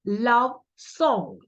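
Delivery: "love song" with equal weight on LOVE and SONG; both syllables stressed. Shown as a demonstration. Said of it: "Both syllables are stressed".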